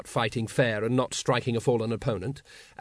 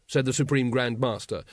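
usual speaking voice

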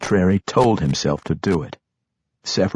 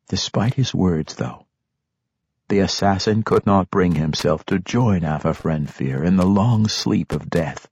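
usual speaking voice

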